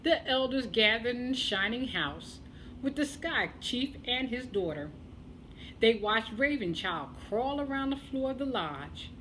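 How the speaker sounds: usual speaking voice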